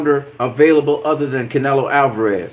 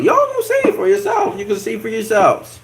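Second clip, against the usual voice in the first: squeaky falsetto insistence; high-pitched taunt; 8 semitones higher than usual. Real, not acted.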